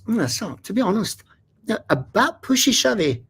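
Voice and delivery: high Scottish voice, clipped verdict; an understated Scot, pitched well up; Scottish accent. Retold or imitated. Scottish man judging the crowded show, in a high Scottish accent. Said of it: "the Scottish guy goes".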